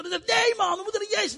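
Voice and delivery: high, urgent pleading; excited leper. Acted. Leper insists to the others they return to Jesus, high and urgent. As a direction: pull your friend along.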